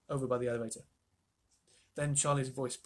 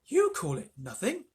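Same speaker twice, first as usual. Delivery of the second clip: slow indignant outcry; indignant cry; 6 semitones higher, 3 dB louder than usual. A narrator's reading. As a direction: cry out in disbelief. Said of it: "cried poor Missus Bucket".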